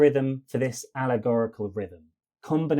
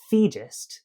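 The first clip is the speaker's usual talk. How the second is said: "thegist" with a higher-weighted first syllable, falling away; first syllable stressed; 3 semitones higher than usual. Shown as a demonstration. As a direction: hit the start of "thegist". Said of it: "focus changes from second to first".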